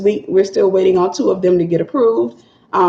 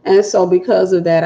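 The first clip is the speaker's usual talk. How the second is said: ordinary talk, nothing marked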